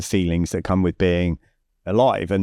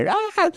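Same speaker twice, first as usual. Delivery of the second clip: mock scream. Rider throwing herself mentally into the ride, mock scream. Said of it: "started screaming".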